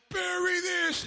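high, loud mocking heckle; jeering yell. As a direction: taunt the player at full volume. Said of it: "And they're like".

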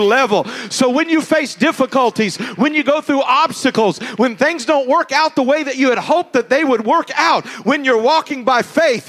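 loud, high, fervent preacher build; full-throated sermon climax; preaching at full force. Acted. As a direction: preach it out to the congregation.

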